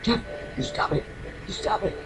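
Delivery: put-on a frightened girl whispering at her brother to stop. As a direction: hiss it like a kid terrified in the dark. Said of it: "you know, freaking out".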